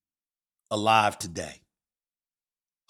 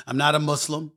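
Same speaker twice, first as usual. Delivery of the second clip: usual speaking voice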